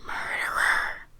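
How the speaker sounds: slow, drawn-out ghostly whisper. A narrator's reading. Second ghostly voice accusing the old man, slow stage whisper. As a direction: stretch the whispered accusation out slowly. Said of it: "another voice whispered louder".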